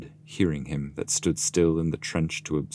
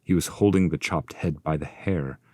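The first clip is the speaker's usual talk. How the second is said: ordinary delivery, nothing marked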